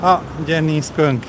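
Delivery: ordinary talk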